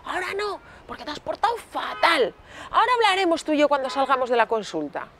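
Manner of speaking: high-pitched, indignant parent refusal; scolding parent. Retold or imitated. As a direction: tell a child off, imitating a cross parent. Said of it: "el papá o la mamá dicen".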